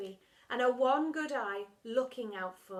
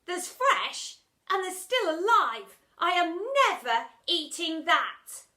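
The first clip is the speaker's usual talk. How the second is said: 1 loud protest; 8 semitones higher, 12 dB louder than usual. Acted. Girl protests loudly.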